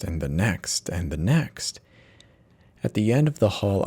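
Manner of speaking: ordinary delivery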